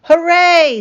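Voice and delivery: high, loud cheer; a burst of childish joy; cheering children. A narrator's reading. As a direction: cheer like excited kids.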